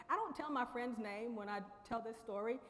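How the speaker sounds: ordinary talk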